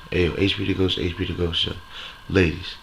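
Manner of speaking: usual speaking voice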